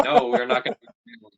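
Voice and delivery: fast, high mock parent refusal; imitating parents flatly refusing. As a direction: rattle off the parents' no in a put-on voice.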